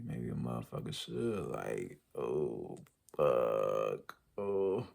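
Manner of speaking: very low, drawn-out groaning words; dismayed groan. Real, not acted. Vlogger dreading having to go back for insurance, groaning. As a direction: let the words sink into a defeated groan.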